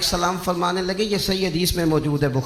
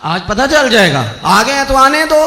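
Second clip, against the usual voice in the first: raised, louder defiant retort; retold warrior's loud dare; defiant challenge; 6 semitones higher, 8 dB louder than usual. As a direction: throw down a challenge, voice rising. Hamza defiantly answers Umar's arrival.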